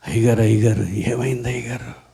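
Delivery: low, hushed worried voice of the youths; timid youths. Retold or imitated. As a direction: ask the pastor in a hushed, nervous hush. Timid youths ask the pastor.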